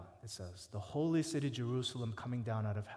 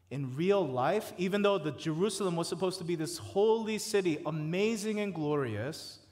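louder repeat; 4 semitones higher, 6 dB louder than usual. Real, not acted. Preacher repeats line louder.

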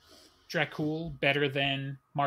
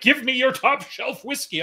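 high, loud mock demand; demanding drunk; 11 semitones higher, 5 dB louder than usual. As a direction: bark the order up high. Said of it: "be like".

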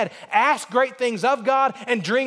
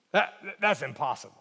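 sceptical thought; 6 semitones lower, 5 dB quieter than usual. Retold or imitated. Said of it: "sitting there thinking".

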